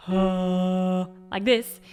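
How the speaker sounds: sung heavy and dark, without twang; dark, heavy low note. Shown as a demonstration. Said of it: "sounds pretty heavy and dark".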